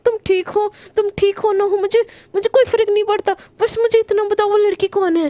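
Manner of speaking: high, fast jealous rant; angry outburst. A narrator's reading. Priyanka scolding Garima on the phone, jealous and angry. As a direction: unload it all in one breath. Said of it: "एकदम गुस्से में बोलती है".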